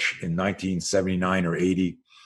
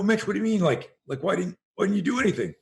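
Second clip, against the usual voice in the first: much higher, baffled disbelief; mock-exasperated higher voice; incredulous; 10 semitones higher, faster than usual. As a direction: coach can't believe what he's hearing. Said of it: "I go".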